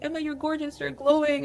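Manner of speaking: mimicking a coworker raving about her hair; gushing coworker. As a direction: coo it like a gushing fan. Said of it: "she kept telling me".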